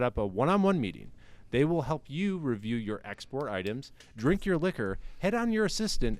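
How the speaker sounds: hammed-up radio ad voice, selling hard; mock ad read. Host reads a mock sponsor spot for his listeners, in a hammed-up ad voice. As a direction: pitch the fake spy front like a cheesy commercial.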